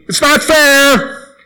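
put-on high, slow whining kid voice; whiny child. Boy complaining to his mom at dinner, high whine. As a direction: whine it like a little boy at the table. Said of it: "then I would say".

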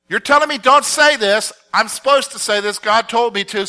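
high, loud, insistent comeback; acted-out indignant reply; defiant retort. Acts Peter answering the council back.

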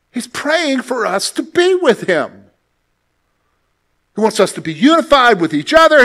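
fervent pastor preaching; full-force preaching. Real, not acted. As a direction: preach it out with fire.

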